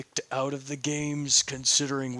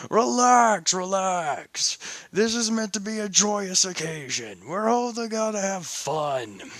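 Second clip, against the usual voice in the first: cheerful Belle; 5 semitones higher than usual. Acted. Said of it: "Belle turns around and says".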